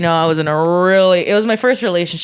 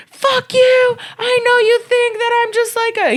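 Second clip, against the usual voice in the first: much higher hammed-up angry whine; angry boyfriend. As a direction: spit it out as the furious boyfriend. Boyfriend cursing her out.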